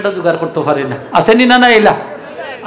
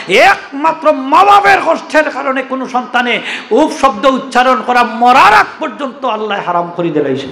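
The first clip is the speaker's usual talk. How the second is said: higher, rising preacher's list, each line hammered; preaching build-up; 4 semitones higher than usual. Acted. Preacher listing wrongs to the congregation, building and emphatic. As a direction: build the list toward the climax.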